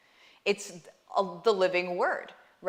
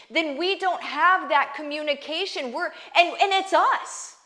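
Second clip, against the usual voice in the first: rising, louder preacher's appeal, pressing each point home; fervent preaching; 4 semitones higher, 6 dB louder than usual. Acted. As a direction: preach it to them with building conviction. Preaching with building force.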